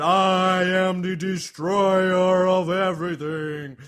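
slow, loud, drawn-out monster roar; giant's yell. Acted. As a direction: bellow it slowly like a giant. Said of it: "And you yell".

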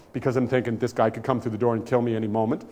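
ordinary talk, nothing marked

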